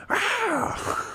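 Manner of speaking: very high, far-off imitated eagle screech. Mimicking the eagles he hears while knocking on doors, high screech. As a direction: let out a piercing high bird cry like a circling eagle. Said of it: "you can hear eagles screeching".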